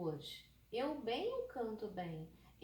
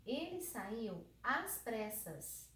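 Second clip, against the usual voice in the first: slow, deliberate "Ele saiu às pressas"; slow example read; much slower than usual. Shown as a demonstration. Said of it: "eu dissesse assim, ó".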